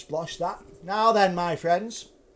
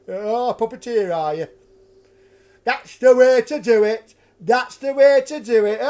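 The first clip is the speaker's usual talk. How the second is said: mocking drunk; 4 semitones higher, 7 dB louder than usual. Drunk ruffian taunting the puppeteer with a loud mock Punch voice. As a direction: taunt him in a squawky Punch voice. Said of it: "took the piss out of this puppeteer".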